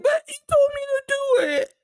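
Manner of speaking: very high, crying whine; mock tearful whining; whiny excuse. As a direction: whimper the excuse like a scolded kid. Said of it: "And he's like".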